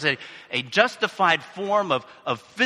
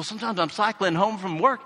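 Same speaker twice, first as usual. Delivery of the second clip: ordinary delivery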